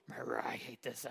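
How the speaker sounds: a low whispered grumble. Shown as a demonstration. Preacher demonstrating a grumble, whispered low. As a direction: grumble it under your breath. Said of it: "it's a low".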